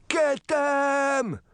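high, commanding shout to the rabbits; boy yelling a battle command, pitched high. Acted. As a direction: order your furry army to attack. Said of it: "Wendell shouted".

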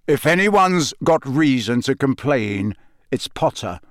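ordinary delivery, nothing marked

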